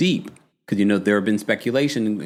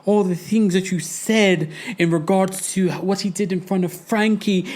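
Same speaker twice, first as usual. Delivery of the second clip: higher mock impression of Julia, gushing; 7 semitones higher than usual. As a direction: mimic a gushing castmate, pitched up. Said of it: "Julia's like".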